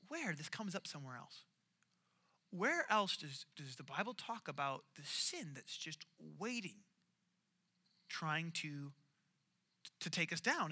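quiet musing. Retold or imitated. Retold inner thought while reading.

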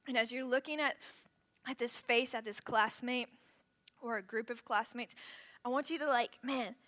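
hushed reflective prayer; guided prayer. Acted. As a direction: guide them gently into prayer.

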